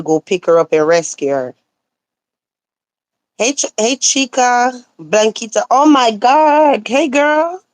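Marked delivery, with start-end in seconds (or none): none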